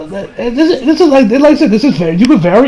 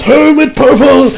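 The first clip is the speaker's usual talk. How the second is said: high, loud, drawn-out battle cry; acted attack call; 5 semitones higher, 3 dB louder, slower than usual. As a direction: announce your special move with force. Joseph calling out his stand attack.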